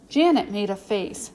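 usual speaking voice

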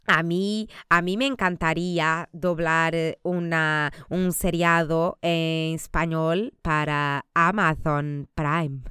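slow, careful Spanish in a put-on accent. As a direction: speak it like a proud Spanish dubbing actor. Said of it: "Que espanhol incrível".

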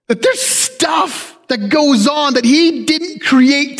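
slow, drawn-out, shouted preaching line; fiery preacher emphasis. Real, not acted. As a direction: drive the point home slowly.

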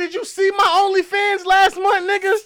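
loud, high mock-indignant female boast; boastful woman. Woman with the bag tells off her man, boastful. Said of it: "a female with the bag would be like".

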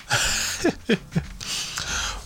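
soft, high-pitched giggle; quiet breathy laugh, rising high; amused giggle. Real, not acted. Host picturing couple dressed as giant cats, giggling.

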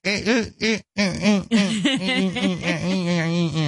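high stammering eh eh eh; [name]'s Benji impression: high repeated eh sounds. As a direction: stutter out a string of high ehs like Benji. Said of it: "I do do a Benji".

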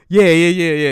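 loud, rapid mocking yeahs; mock eager yes-man. Mocking a journalist nodding along, loud eager yeahs. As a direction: bark out yeahs like a sycophant.

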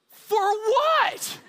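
high-pitched, exasperated disbelief; retold squeal of disbelief; incredulous retort. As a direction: throw it back at them, baffled. His baffled retort to panicking friends.

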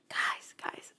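whispered for dramatic effect; stage-whispered address; hushed emphasis. Real, not acted. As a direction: whisper it urgently to get attention. Building excitement about a show.

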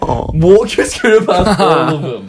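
retold order, high and drawn out through laughter; laughing disbelief. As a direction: slowly echo the order, cracking up. Retells examiner's order, laughing in disbelief.

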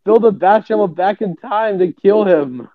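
ordinary delivery

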